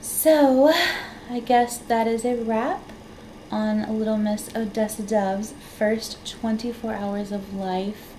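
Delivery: ordinary talk, nothing marked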